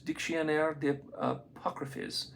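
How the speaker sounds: slow, careful French accent. Shown as a demonstration. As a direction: read the French title in a French accent. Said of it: "My French accent sucks".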